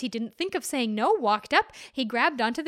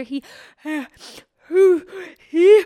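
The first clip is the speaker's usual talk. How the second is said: rising, louder and higher ah-ah-ah before a sneeze; building sneeze; 5 semitones higher, 12 dB louder, much slower than usual. Acted. Man about to sneeze, rising louder and higher. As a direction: wind up a huge sneeze. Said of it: "he let out such a sneeze".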